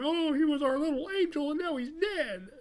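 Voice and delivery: very high, hammed-up lament; mock wailing grief. Parents suddenly mourning their son, mock wailing. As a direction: wail it like a fake mourner.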